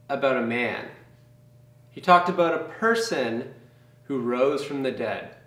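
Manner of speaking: usual speaking voice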